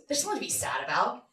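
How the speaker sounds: quick, offhand brush-off; dismissive chuckle. Acted. Dad brushing off a sad child, dismissive. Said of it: "he chuckles and goes".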